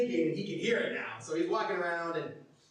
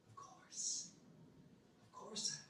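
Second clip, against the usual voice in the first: soft, breath-light promise; hushed, gentle assurance; tender reassurance; 3 semitones higher, 14 dB quieter, slower than usual. Acted. Preacher gives Jesus's answer to the hurting, tender reassurance.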